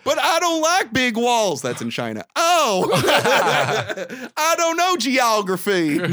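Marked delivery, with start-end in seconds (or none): 0.0-1.6 mock character voice. Joining the joke character voice. 4.4-6.0 laughing mock voice. Laughing mock character line.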